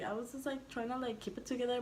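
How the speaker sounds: ordinary delivery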